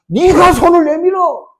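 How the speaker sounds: God's command. Acted. God telling the people to reach out, in a slow high command. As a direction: lay each word down slowly and forcefully. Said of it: "하나님은 뭐라 합니까?".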